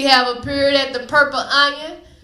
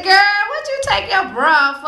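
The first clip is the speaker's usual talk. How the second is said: a lightly higher, sassy friend voice; friend's voice; 7 semitones higher than usual. Retold or imitated. Teller voicing her friend in the desert, lightly higher. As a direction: play the friend scolding with a light lift.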